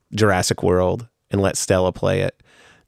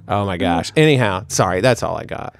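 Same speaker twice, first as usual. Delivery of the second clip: ordinary talk, nothing marked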